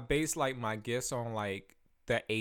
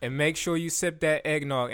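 ordinary delivery

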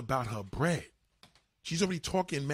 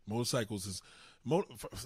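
usual speaking voice

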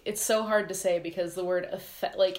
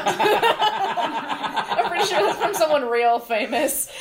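laughing while talking; 8 semitones higher, 6 dB louder, slower than usual. Real, not acted. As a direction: talk through a laugh, higher. Laughing at stolen line.

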